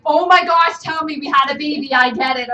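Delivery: loud, hammed-up guess; mock husband excitement. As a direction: playfully shout the guess. Husband voiced guessing loudly.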